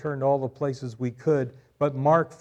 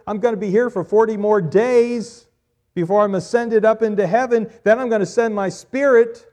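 higher, loud preaching climb; preaching at full force; 6 semitones higher, 9 dB louder than usual. Acted. Preacher voicing Jesus's words to Mary, loud and fervent. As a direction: push it out with conviction.

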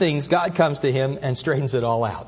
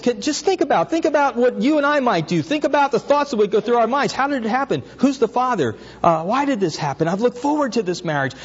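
higher, voicing a troubled Joseph mulling it over; Joseph's worried thoughts; 8 semitones higher than usual. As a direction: pastor puts himself in Joseph's sandals, fretting aloud. Pastor voices Joseph's anxious thoughts.

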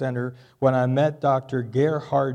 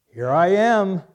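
loud, high grand entrance; hammed-up self-important proclamation; boastful pride; 6 semitones higher, 9 dB louder than usual. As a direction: announce yourself as Pride walking in. Pride personified announces itself.

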